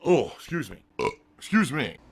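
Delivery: words pushed out through a burp. Real, not acted. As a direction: let a burp roll into the words. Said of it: "Here comes a burp".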